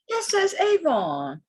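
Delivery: high, drawn-out disbelief; stretched-out incredulous exclamation; amazed discovery. Real, not acted. Amazed again at Avon mark.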